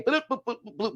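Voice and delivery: high-pitched bouncing bloop noises; tumbling bloops. Retold or imitated. Reviewer mimicking vocals thrown down the stairs, tumbling bloops.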